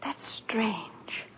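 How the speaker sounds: soft whisper of puzzlement. Acted. Mother puzzling over the house, a whisper to herself. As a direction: breathe it to yourself, bewildered.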